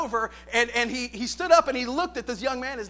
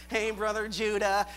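nasal voice; 3 semitones lower than usual. Retold or imitated. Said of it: "a real nasally voice. He talked like this".

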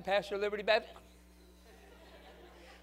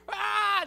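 imitated scream; 8 dB louder than usual. Screaming heard through the door.

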